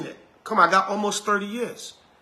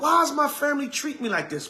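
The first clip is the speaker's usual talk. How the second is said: higher, wounded questioning; hurt believer; 7 semitones higher than usual. Retold or imitated. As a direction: ask it high, baffled and hurt. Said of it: "you're gonna be like".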